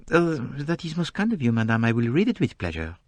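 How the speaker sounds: put-on polite Belgian-French detective accent; courteous French-accented reply; gentle French accent, very polite. A narrator's reading. Poirot politely accepts.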